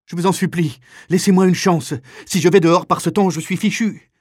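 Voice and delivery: high, loud, frightened begging; desperate pleading. Acted. Trembling man without clogs to the kapo, desperate plea. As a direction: beg urgently for your life. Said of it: "qui tremble de tous ses membres".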